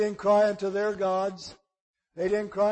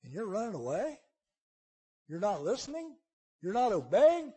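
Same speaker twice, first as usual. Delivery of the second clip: quiet disbelief; 6 dB quieter than usual. Acted. Sailors' disbelief, dropped to quiet.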